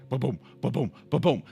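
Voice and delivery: low, hushed heart thump; imitated heartbeat. Driver mimics their own heart rate, low imitated thumping. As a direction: mimic a pounding pulse under the voice. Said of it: "heart rate just like".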